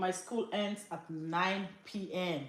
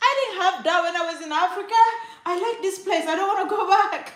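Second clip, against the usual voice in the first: put-on very high kid voice, building louder; delighted child; 9 semitones higher, 8 dB louder, faster than usual. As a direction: gush and insist like a small child.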